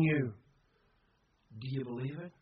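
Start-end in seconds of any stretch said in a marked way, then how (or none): 1.6-2.3 soft, low, searching question; quiet challenge. Real, not acted. Preacher asks congregation whether they believe, quietly. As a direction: drop down and put it to them gently.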